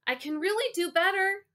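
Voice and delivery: put-on high-pitched pleading boy; eager kid begging for another chance; calling back. A narrator's reading. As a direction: call out insistently in a high voice. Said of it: "I called back to the table".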